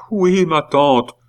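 high, slow, falling wail; sobbing reply. A narrator's reading. Nephew admitting it to his aunt, sobbing. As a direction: answer in a loud acted boo-hoo. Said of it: "les sanglots de Pitou redoublèrent".

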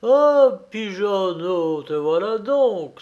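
high, loud cry of discovery; triumphant, drawn-out exclamation; exclaiming. A narrator's reading. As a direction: cry out on spotting the prize. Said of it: "s'écria mon grand-père".

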